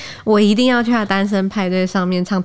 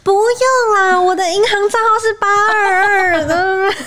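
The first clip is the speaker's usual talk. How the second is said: high, louder teasing falsetto; mock polite refusal; 13 semitones higher, 4 dB louder than usual. Co-host teases by pretending she gave her bank account. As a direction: fake a refusal while slipping in the account.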